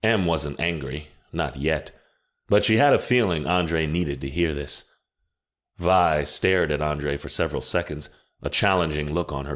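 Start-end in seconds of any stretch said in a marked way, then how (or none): none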